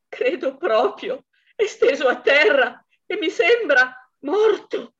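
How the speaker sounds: the wife tells the police her husband looks dead, trembling; shaken wife. Acted. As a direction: force the words out through shock. Wife reports death on phone.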